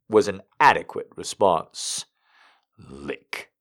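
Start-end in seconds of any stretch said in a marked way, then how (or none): 2.8-3.5 low, soft, firm command; quiet command. A narrator's reading. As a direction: order it in a low murmur. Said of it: "he commanded".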